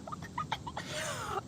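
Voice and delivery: high, soft laughing fit; real laugh, trailing on high and quiet; helpless laughter. Real, not acted. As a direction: lose it laughing. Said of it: "for me to be laughing like this".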